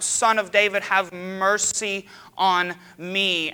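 loud, slow, stretched-out cry. Retold or imitated. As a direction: plead loudly, dragging each word. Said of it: "he cries out".

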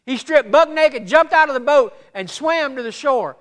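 loud, high-pitched sermon storytelling; full-force preaching. Real, not acted. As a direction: preach the story with excited force, punching key words.

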